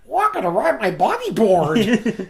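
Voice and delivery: high, slow nasal Tails whine. Retold or imitated. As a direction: whine it like a nasal little fox. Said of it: "with, with kind of nasally voice".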